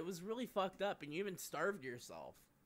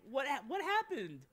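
amused disbelief; 10 semitones higher than usual. Real, not acted. Hearing of the cancellation.

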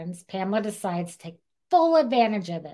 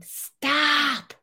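shocked stop; 8 semitones higher than usual. Real, not acted. Reacting to shocking story.

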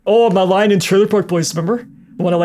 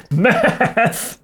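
drawn-out, higher METH; imitated show line; 5 semitones higher than usual. Pretending to startle Mom with the line, drawn-out imitation. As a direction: blurt the word like the show's character. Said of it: "I could go".

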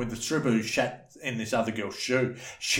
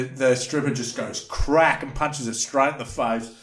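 ordinary talk

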